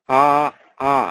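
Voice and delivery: আ held long. Shown as a demonstration. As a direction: stretch the আ. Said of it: "দীর্ঘায়িত". (দীর্ঘ আ)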